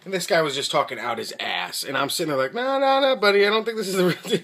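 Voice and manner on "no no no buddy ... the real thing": much higher, a bit louder and quick, mock polite; polite disbelief. Customer tells the clerk his scope is fake, higher and politely doubtful. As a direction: gently shoot down his claim, half laughing.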